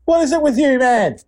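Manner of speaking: high-pitched exasperated complaint. Real, not acted. Host despairs of Dave's swearing, exasperated high voice. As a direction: plead with him, pitch jumping up.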